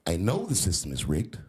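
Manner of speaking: quoted candidate. Retold or imitated. Comedian retells the candidate's answer to the moderator, lightly imitated. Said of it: "He said".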